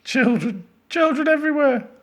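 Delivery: very high, loud panicked scream; mounting horror at full pitch. A narrator's reading. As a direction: shriek it as if overrun. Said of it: "screamed Edmund".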